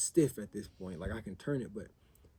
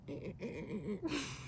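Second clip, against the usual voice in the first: high, soft imitated van squeal; horrible noise imitation; 9 semitones higher, 10 dB quieter than usual. Driver imitating the noise his van made on start-up, poor squeal imitation. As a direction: mimic the van's noise, high and quiet. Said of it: "like, that's a horrible imitation".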